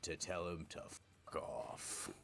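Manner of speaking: ordinary talk, nothing marked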